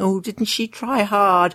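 high, drawn-out mock sympathy; patronizing. Politicians patting her on the head, patronizing. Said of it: "patronizing her and saying".

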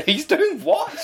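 very high, slow, drawn-out disbelief; hammed-up astonishment from the agent; incredulous agent. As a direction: stretch the shock out, astonished. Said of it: "he did apparently went".

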